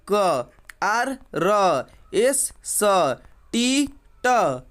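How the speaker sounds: each letter name, then its bare sound, drawn out slowly; letter-sound drill. Shown as a demonstration. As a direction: recite name and sound in turn, like "के, क", with pauses. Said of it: "इसका जो real शुद्ध उच्चारण है". (अक्षर का नाम, फिर उसकी ध्वनि, धीरे-धीरे)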